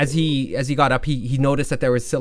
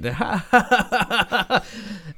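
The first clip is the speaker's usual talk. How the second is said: high, staccato mock cackle; mocking laughter; 7 semitones higher than usual. Hooded guys jeering from the top of the gorge, mocking laugh. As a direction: laugh down at them from above. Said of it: "laughing from the guys".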